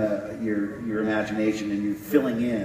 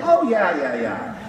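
breezy brush-off, pitched up; dismissive reassurance; 5 semitones higher than usual. Retold or imitated. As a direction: wave it off, no worries. Crew brushing off his concern.

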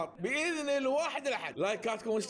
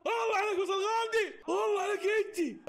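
delighted praise; 9 semitones higher than usual. Real, not acted. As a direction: hail the scorer. Praising a player's goal.

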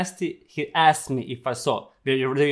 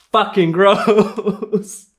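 laughing disgust; 6 semitones higher, 5 dB louder, much slower than usual. Real, not acted. Disgusted at video.

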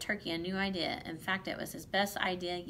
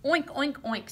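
loud, higher storybook pig oinks; pig oinking; 6 semitones higher, 6 dB louder than usual. A narrator's reading. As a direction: oink it out like a pig. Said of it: "snorted Pig".

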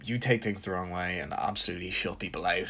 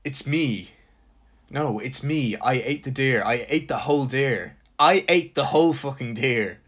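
louder with each repeat; building insistence, louder and louder; insistent repeating; 4 semitones higher, 6 dB louder than usual. Retold or imitated. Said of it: "you kept shaking me and repeating".

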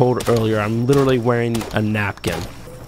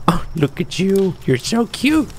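higher cutesy pet talk; cooing; 7 semitones higher than usual. Real, not acted. Player gushing at his cute new pet pal, cooing higher. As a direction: gush over the little creature.

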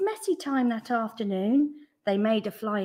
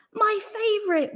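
high, eager little cry; excited cry; 12 semitones higher, 4 dB louder than usual. A narrator's reading. Wilson delighted at football, excited cry. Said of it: "Wilson cried".

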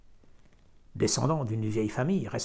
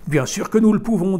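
loud, high flat refusal; curt loud refusal; 7 semitones higher, 11 dB louder than usual. A narrator's reading. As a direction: shut her down. Farmer refusing harshly.

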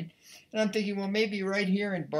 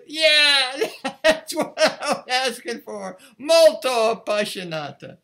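higher, louder, laughing, slow; laughing reply; 7 semitones higher, 10 dB louder, slower than usual. Retold or imitated. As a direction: laugh back at the player in delight. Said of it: "Ozawa looks at him and he goes".

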